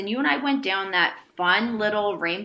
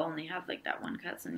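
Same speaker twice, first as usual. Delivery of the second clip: whisper; 5 semitones lower, 15 dB quieter than usual. Real, not acted. Whispered aside.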